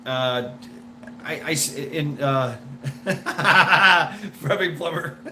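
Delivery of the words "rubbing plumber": laughing through the words. Real, not acted. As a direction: cracks up mid-joke.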